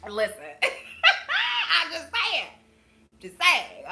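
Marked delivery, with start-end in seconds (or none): 0.4-1.8 high cackling laugh; high burst of laughter. Real, not acted. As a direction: let out a big high laugh. Laughs after sassy advice.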